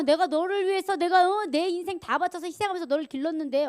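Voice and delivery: high, loud outraged complaint; indignant parent. Acted. Parent who sacrificed everything for the child, indignant. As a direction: protest loudly as a wronged parent. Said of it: "어이가 없는 거예요".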